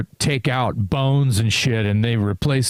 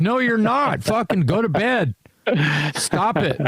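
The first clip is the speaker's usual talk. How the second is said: high, exasperated scolding, pitched way up; mock scolding; 9 semitones higher than usual. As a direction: scold a friend to go rest. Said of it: "And I'm like".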